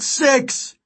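very high desperate shout; high-pitched countdown cry; anguished yell. A narrator's reading. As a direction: yell the number in tearful panic. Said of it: "Then he yelled".

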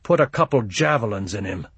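ordinary delivery, nothing marked

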